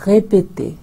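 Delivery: répéter, "ray-pay-TAY", crisp French é; French model word. Shown as a demonstration. Teacher models répéter for students, crisp French. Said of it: "मैं फिर से repeat करूँगी".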